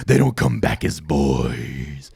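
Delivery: slow, low, ominous villain; sinister slow line. Retold or imitated. Coachman telling the fox the boys' fate, slow and ominous. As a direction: drag it out like a creepy secret. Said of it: "he's like".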